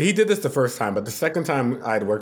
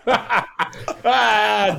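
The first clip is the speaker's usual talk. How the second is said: high retold burst of laughter; laughing cry, pitched way up; cracking up; 9 semitones higher, 3 dB louder than usual. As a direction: re-enact helpless laughing. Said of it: "I'm cracking up. I'm like".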